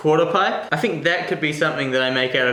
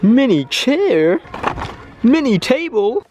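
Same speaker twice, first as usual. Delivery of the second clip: excited; 7 semitones higher, 3 dB louder, slower than usual. Real, not acted. Delighted at tiny furniture.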